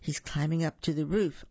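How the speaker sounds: ordinary delivery, nothing marked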